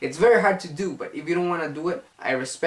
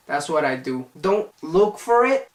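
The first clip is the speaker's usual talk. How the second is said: ordinary delivery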